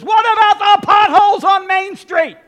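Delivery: high, loud heckling yell from the crowd; heckler shout. Acted. Knucklehead in the crowd heckling the president, loud shout. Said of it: "some knucklehead in the crowd says".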